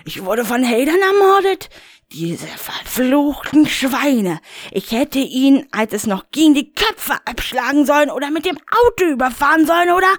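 high, indignant Rainer voice; a high, put-out grumble; Rainer, high and agitated. Acted. Rainer brushes off the caller, high and huffy.

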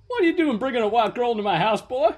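hammed-up outraged boss voice; mock angry chief. Retelling the chief scolding a recruit, mock outrage. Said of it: "the chief goes".

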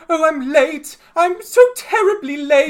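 high, loud, panicked rabbit voice. Acted. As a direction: cry out in a flustered, high-pitched rabbit voice.

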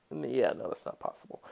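unvoiced, breathy throwaway under the breath; whispered aside. Real, not acted. Host whispers to himself that simplicity is impossible, voiceless aside. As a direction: whisper it to yourself as if correcting a thought. Muttered self-correcting aside.

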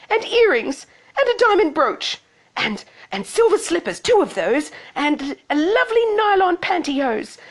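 high, insistent bratty voice; high-pitched, excited wheedling; demanding Cindy. Acted. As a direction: reel off demands like a spoilt girl. Cindy continues her shouted demands.

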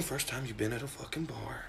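sudden whispered aside. Real, not acted. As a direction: drop to a whisper.

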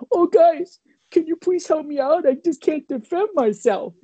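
high, whiny mock plea; mocking the heckler in a high whiny voice; mocking whine. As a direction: imitate a helpless kid begging his friends. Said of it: "I started mocking the kid".

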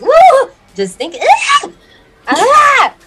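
high, loud, shuddering shriek. Real, not acted. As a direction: shriek at the thought of the cold. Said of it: "I just shrieked uncontrollably".

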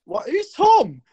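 rising, high-pitched confusion; mock bafflement. Scottish dude questioning the English guy, mock bafflement. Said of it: "the Scottish dude's like".

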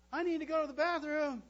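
put-on high, loud child calling out; small kid calling at bedtime; little child voice. Child calls from bedroom.

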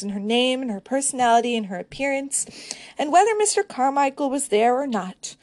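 expressive literary reading voice; reading aloud. Acted. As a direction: read the passage like an audiobook. Reads passage from the novel.

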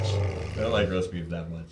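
mock sob.

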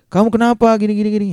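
high-pitched, worried retold question; mock-frantic, pitched well up; panicked concern. Narrator to injured Niken over chat, panicked concern.

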